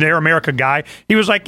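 usual speaking voice

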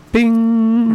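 high, loud sound-effect "bing"; ding it like a lightbulb going on; excited bing. Real, not acted. Idea hits him.

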